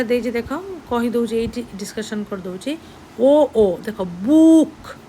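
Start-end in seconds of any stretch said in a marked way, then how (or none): 3.2-3.8 loud, separated O sounds; double-o sound demo. Shown as a demonstration. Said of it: "double ଓ ଆସୁଛି". 4.0-5.0 "ବୁକ୍" loud, vowel drawn out; double-o sound demo. Shown as a demonstration. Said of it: "double ଓ ଆସୁଛି".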